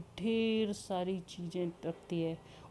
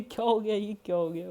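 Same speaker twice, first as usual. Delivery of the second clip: gleeful; 3 semitones higher, 6 dB louder than usual. Acted. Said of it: "Clara भी इतनी जोर-जोर से हंस रही है".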